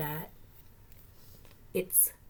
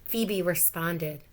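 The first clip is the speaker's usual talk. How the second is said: ordinary delivery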